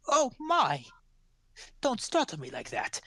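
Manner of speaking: put-on high, fluttery old crone's gasp; a frail old woman caught off guard, voice up high; startled old woman. Old pie seller startled by a gnome at night, high old voice.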